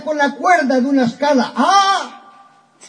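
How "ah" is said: a high, fierce cry of ah. A narrator's reading. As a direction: bark out the ah fiercely. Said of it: "dice con fiero acento". (¡Ah! con fiero acento, agudo)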